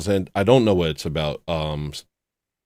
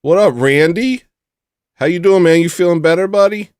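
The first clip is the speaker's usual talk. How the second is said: loud, high shout-out to a viewer; excited greeting; 8 semitones higher, 7 dB louder than usual. Real, not acted. Streamer greets a viewer by name, loud and high. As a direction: hype them up by name.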